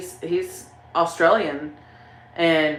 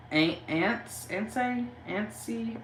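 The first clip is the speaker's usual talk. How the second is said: tentative, soft, rising guesses; hesitant tries, each softer and higher; unsure pronunciation; 5 semitones higher, 6 dB quieter, slower than usual. Real, not acted. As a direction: sound out an unfamiliar name, questioning. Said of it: "I don't wanna pronounce it wrong".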